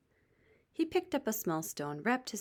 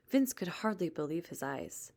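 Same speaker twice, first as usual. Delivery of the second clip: ordinary delivery, nothing marked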